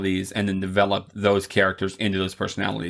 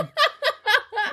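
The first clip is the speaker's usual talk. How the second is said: shrill squeaky cackle; high squealing laugh. Real, not acted. S0 laughs during outro.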